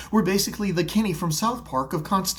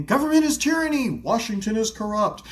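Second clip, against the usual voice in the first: mock yell; 5 semitones higher than usual. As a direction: yell it like an angry rally-goer. Mock Republican protester yelling.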